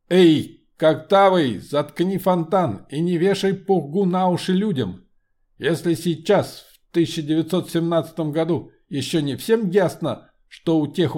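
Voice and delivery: loud commanding metallic voice; steely voice. Acted. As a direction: shout down the crowd like an iron automaton. Homunculus heckles Lenin.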